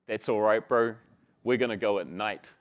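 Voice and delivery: low, easy assurance, mildly proud; confident. Acted. Kiwi boy reassuring his mate, low calm confidence. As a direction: proclaim it with a touch of cool certainty. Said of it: "a surge of confidence".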